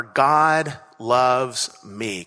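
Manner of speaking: each word slowly and separately; slow, deliberate. Retold or imitated. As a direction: let every word land, slowly. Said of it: "three key words in it are".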